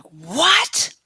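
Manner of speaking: a breathless whispered what; stunned whisper. Retold or imitated. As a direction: gasp it out in disbelief. Casey stunned by the study date.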